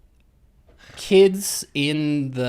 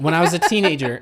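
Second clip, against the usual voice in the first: louder, higher restart over the laughter; 6 semitones higher, 7 dB louder, faster than usual. Shown as a demonstration. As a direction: start over, louder, to be heard.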